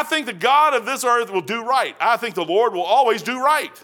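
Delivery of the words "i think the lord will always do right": ordinary talk, nothing marked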